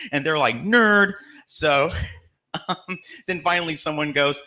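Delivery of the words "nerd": a loud, much higher jeer; mocking jeer. Drinking teens jeer at the church kid, loud high sneer. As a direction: sneer it like a teenager putting down a nerd.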